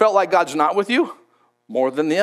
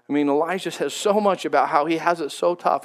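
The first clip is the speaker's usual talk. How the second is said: ordinary talk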